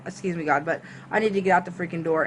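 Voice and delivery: plain reading voice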